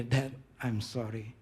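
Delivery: low, slow, subdued confession; softly voiced retold remorse; imitated tearful apology. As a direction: play a son quietly owning up on the phone. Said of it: "Dia nangis nelponnya".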